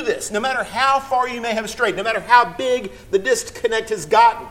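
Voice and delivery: loud, high, slowed pulpit exhortation; impassioned sermon appeal; preaching at full force. Acted. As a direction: urge the congregation with all you have.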